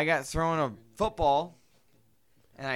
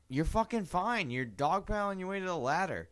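higher, softer imitation of an aunt; dismissive reassurance; 6 semitones higher, 6 dB quieter than usual. Aunt to panicking child in the pool, dismissive. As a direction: imitate an unimpressed relative brushing off panic. Aunt's dismissive reply, retold.

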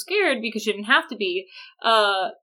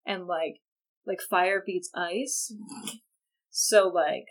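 hushed, lower, slow and husky-soft parody; mock sultry murmur; 5 semitones lower, 11 dB quieter than usual. Jacob teasing Bella about being hot, hushed mock seduction. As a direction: purr it back like a cheesy heartthrob.

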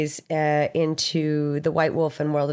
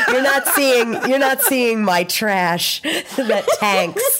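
laughing; 6 semitones higher, 4 dB louder, slower than usual. Real, not acted. Laughing at her own bad posts.

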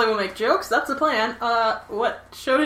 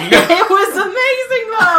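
high, laughing enthusiastic protest; excited; 10 semitones higher, 5 dB louder, slower than usual. Real, not acted. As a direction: gush about it while laughing.

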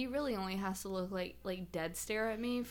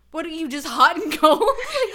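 mock outburst; 7 semitones higher, 13 dB louder than usual. Boyfriend's baffled retort.

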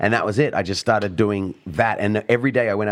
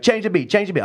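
demanding crowd; 10 semitones higher than usual. Retold or imitated. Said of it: "they'd be like".

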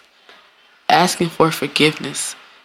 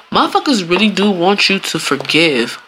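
usual speaking voice

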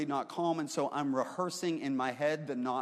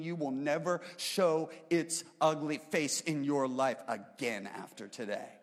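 full-force sermon reading, raised; preaching; 3 semitones higher, 4 dB louder than usual. Acted. Pastor preaching scripture to the congregation, full-force preaching.

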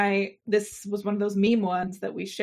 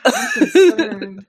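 slow, loud, laughing it out; 8 semitones higher, 9 dB louder, much slower than usual. Real, not acted. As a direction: laugh through fake concern. Mock concern while laughing.

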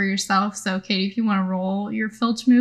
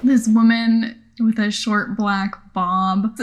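ordinary talk

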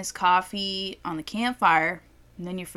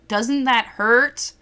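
slow, louder, disbelieving; incredulous question; 3 semitones higher, 6 dB louder, much slower than usual. Retold or imitated. Asking the injured dad, slow and incredulous. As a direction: drag it out in disbelief. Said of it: "I was like".

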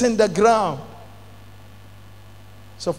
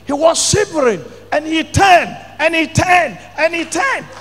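repeated lines climbing to a shouted fall; building sermon climax; 6 semitones higher, 3 dB louder than usual. Acted. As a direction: build each turn up to a loud 'fell down'.

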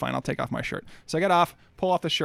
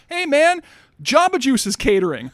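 high, loud, friendly hail; party guests; 9 semitones higher, 7 dB louder than usual. Retold or imitated. Party guests welcoming the pantsless driver, in a loud high friendly hail. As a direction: greet him like a buddy. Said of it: "They're like".

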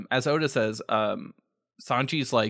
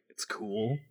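normal talking voice

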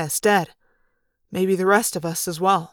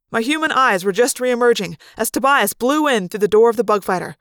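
ordinary delivery, nothing marked

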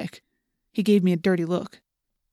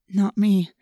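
plain reading voice

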